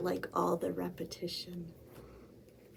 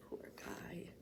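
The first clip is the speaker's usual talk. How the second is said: breathy whisper of pity; whispered aside; 19 dB quieter than usual. Real, not acted. Librarian whispering to the children, pitying. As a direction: whisper it, sorry for him. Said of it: "aside whispered".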